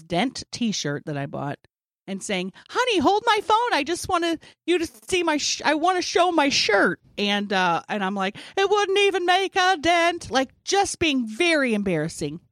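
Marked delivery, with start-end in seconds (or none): 2.7-7.0 high, loud, gushing mom; embarrassing mom voice. Retold or imitated. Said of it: "my embarrassing mom character". 8.6-10.3 high, loud cringey mom quip; proud mom showing off her shirt; embarrassing mom voice. Retold or imitated. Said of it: "my embarrassing mom character".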